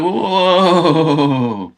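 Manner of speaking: long, high drawn-out mock whoa; stunned reaction. Actor reacting to a drunk director, stunned reaction. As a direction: stretch one astonished whoa, sliding down from high.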